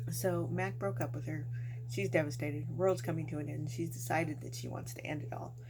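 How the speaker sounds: usual speaking voice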